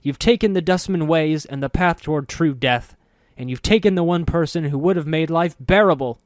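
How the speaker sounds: loud, high, anguished; anguished outburst. A narrator's reading. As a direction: rage at them in grief. Soego's anguished outburst continues.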